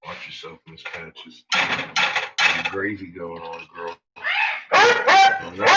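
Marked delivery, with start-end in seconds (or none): 0.1-4.0 soft coaxing. Real, not acted. Talking softly to dog.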